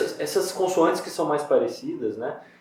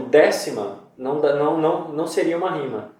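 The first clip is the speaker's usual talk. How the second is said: ordinary delivery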